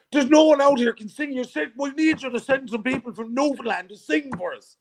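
high-pitched Irish brogue; a playful stab at an Irish accent; [name]'s Irish accent. Retold or imitated. Irish brother-in-law asking for Newfoundland singers, in an Irish accent. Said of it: "Let me see if I can do the Irish accent".

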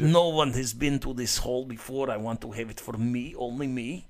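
hammed-up Russian guy accent, held for the bit. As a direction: keep the joking Russian voice going. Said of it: "that is a good accent".

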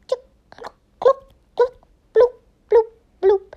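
high squeaky popping sounds, then loud bloops; popcorn popping noises. Retold or imitated. Kid imitating popcorn in a pan, high and loud. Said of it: "on a pan and goes".

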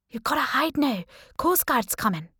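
urgent warning. A narrator's reading. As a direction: hurry her to hide.

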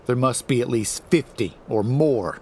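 ordinary delivery, nothing marked

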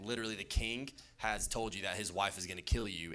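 usual speaking voice

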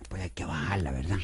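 soft conspiratorial whisper. Acted. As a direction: drop to a hush as asked. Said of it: "baja más la voz".

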